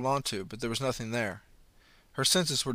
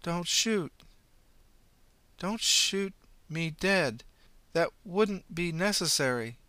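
slow, pitched-up odd voice; slow uncanny plea; eerie inflection; 6 semitones higher, slower than usual. Acted. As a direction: copy the possessed girl's voice. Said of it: "same inflection as the teenage girl".

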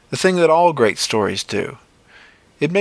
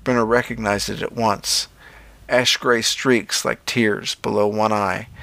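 usual speaking voice

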